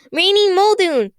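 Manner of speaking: high, loud, slow shout; shouting a name. Acted. Constance shouts a name to resist the machine, high and loud. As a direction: bellow the name out with effort. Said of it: "she shouted".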